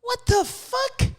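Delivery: high, slow, stunned exclamation; disbelieving what the fuck. Real, not acted. Reacting to people queuing since dawn, stunned and high.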